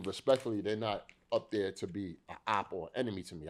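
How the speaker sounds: usual speaking voice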